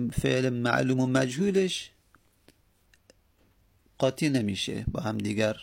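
usual speaking voice

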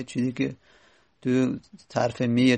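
normal talking voice

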